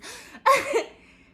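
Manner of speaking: loud high burst of laughter; big laugh. Real, not acted. Laughing hard while telling her podcast listeners.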